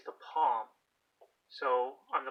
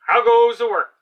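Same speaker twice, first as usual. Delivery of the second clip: high, loud call from the lazy farmer; bossy farmer calling; 9 semitones higher, 20 dB louder than usual. Acted. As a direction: bellow it like a lazy boss from bed. Farmer voiced.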